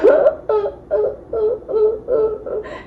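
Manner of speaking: high, hammed-up boo-hoo sobs; acted drunken crying; mock sobbing. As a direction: fake a string of high whimpering sobs. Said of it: "and I'm crying".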